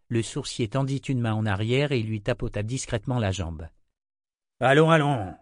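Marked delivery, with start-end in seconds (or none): 4.6-5.4 loud, higher-pitched interjection; calling out sharply. A narrator's reading. Blacksmith silences the grumbling crowd, calling out loudly. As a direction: cut into the protest with raised voice. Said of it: "lança Victor".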